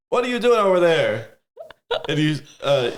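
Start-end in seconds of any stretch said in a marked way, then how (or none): 0.1-1.3 high-pitched holler over the yard; loud call across. Acted. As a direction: call out so the guards can hear. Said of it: "this is loud enough to where the guards".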